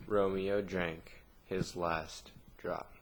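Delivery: ordinary delivery, nothing marked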